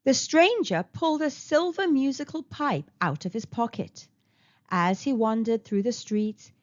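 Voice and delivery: ordinary delivery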